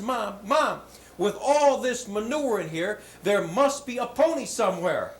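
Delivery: high, loud and eager, slowing into a laugh; gleeful boy. Acted. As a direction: bubble over with hope, laughing at the end. Boy excitedly answers mother.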